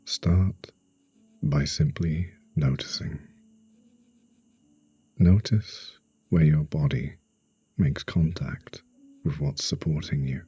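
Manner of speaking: slow meditation voice with long pauses; guided sleep hypnosis. Acted. Hypnotherapist guiding a listener to relax for sleep, slow and soothing. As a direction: ease the listener into stillness, phrase by phrase.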